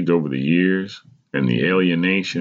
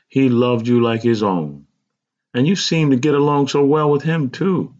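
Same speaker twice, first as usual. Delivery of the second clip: pitched up as an earnest mother; mother's heartfelt voice; 9 semitones higher, 3 dB louder than usual. A narrator's reading. Mother telling child how well he got along with him, heartfelt. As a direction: voice a mother, rising higher as she goes. Mother speaking in narrated story.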